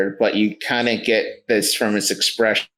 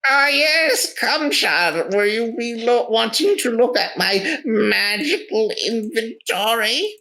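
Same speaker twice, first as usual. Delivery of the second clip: very high-pitched theatrical wizard; eccentric wizard merchant; 13 semitones higher than usual. Acted. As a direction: play a welcoming, showy wizard in a high voice. Wizard merchant offers wares.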